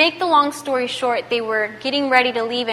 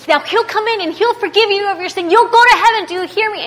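raised, urgent retold preaching; preaching at him; 9 semitones higher, 5 dB louder, faster than usual. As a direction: imitate her pressing the message on him. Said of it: "preaching at him".

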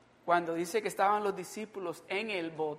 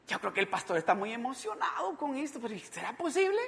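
higher-pitched, incredulous questioning; doubting believer; 8 semitones higher than usual. Acted. Believers wondering whether the pastor is right, doubting. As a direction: voice a doubter, questions lifting at the end. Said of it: "muchos de nosotros decimos".

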